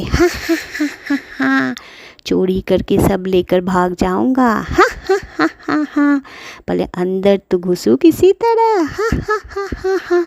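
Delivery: high, hammed-up evil cackle; villain laugh. Thief gloats over his loot, hammed-up evil cackle. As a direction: cackle like a greedy crook.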